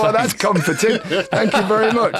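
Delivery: high, laughing mock thanks; laughing sarcasm. Footballer retelling surgeon's joke, laughing sarcastically. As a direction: laugh it out, dripping irony.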